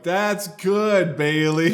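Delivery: loud, high mock encouragement; patronizing praise. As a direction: praise her as if she were a small child. Imitating locals indulgently praising learner.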